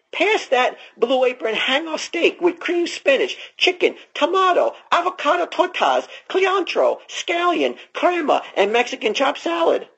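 a hint of a goofy character voice; put-on high voice. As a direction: lift the voice high through the list. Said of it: "doing with his accent".

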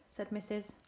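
plain reading voice

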